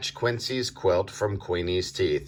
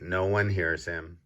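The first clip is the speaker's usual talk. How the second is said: usual speaking voice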